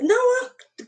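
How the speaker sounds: very high, mock protesting yell; retold child's shouting. Boy keeps protesting at teacher's drawing, high mock shouting. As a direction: imitate a boy starting to yell. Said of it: "começava a gritar".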